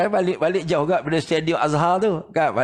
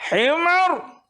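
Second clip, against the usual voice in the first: much higher imitated scolding; mock angry snap; 10 semitones higher than usual. Teacher scolds student for wrong reading, high mock anger. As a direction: bark the one word at a careless student. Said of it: "Dia marah".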